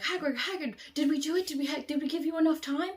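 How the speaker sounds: high, loud, fast eager questions; bursting to know; excited calling. Retold or imitated. The friends running to Hagrid, high excited calling. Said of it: "We were like".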